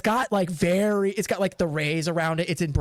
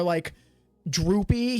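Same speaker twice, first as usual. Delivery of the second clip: ordinary talk, nothing marked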